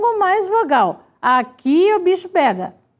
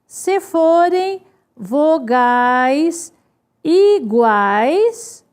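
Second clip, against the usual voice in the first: very slow, with pauses between word groups; drawn-out words, spaced for note-taking; slow dictation; much slower than usual. Shown as a demonstration. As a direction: dictate it for people writing it down. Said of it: "Escrevam lá".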